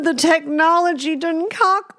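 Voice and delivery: high-pitched comic exasperation; mock exasperation. AI chat cuts out mid seduction.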